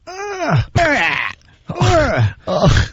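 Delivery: high-pitched mock groans, spaced out; imitated grunting. Imitating the massage customer next to you, grunting. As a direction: groan like the guy behind the curtain. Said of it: "hear the guy next to you going".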